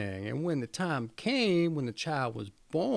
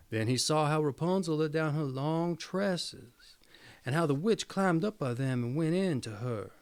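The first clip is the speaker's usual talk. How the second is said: plain reading voice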